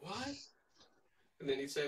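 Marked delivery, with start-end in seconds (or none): 0.0-0.4 higher, questioning "what?"; short rising mock query; mock puzzled reaction. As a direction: imitate someone taken aback. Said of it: "they'd be like".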